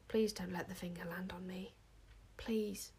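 soft, whispery plea; hushed pleading. A narrator's reading. Wish dreading the finger's touch, hushed pleading. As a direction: plead under your breath.

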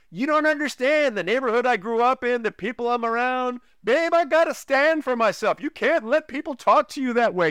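imitating himself insisting he must stand up for himself; mock-indignant arguing with his wife; defiant husband. Retold argument, his own defiant side.

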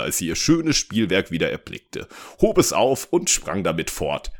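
ordinary delivery, nothing marked

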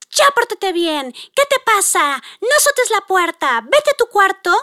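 high-pitched, stretched-out parental telling-off; mock scolding. Mom snaps at daughter over slammed door, acted scolding. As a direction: imitate a mom losing patience. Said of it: "grita, yo le digo".

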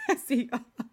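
laughing through the words. Real, not acted. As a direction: say it while breaking into a laugh. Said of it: "repeats it laughing".

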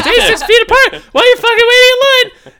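very high, loud laughing yell; shouting. Retold or imitated. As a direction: shout it like a furious sign. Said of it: "foot marks on the ground that says".

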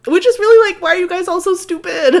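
high, loud laughing outburst; laughing exasperation. Real, not acted. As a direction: laugh it out in disbelief.

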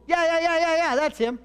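high, loud gushing yeahs, hammed-up; overeager yes. Saul gushes agreement to the witch, high and loud.

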